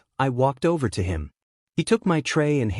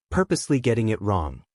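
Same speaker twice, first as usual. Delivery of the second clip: usual speaking voice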